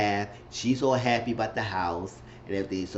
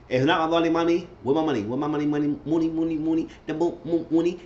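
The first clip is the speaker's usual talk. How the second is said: higher, insistent chant of money; demanding Jada; 5 semitones higher than usual. Retold or imitated. Jada to him, demanding her money, repetitive insistent. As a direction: keep hammering the word money like a bill collector.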